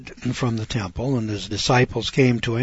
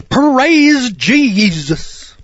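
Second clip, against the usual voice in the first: high, loud, drawn-out mock praise; mock TV preacher; 11 semitones higher, 8 dB louder, much slower than usual. Mocking showy preachers to the congregation, high drawn-out cry. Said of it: "preachers running around out there saying".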